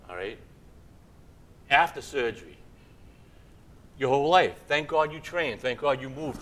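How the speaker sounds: ordinary talk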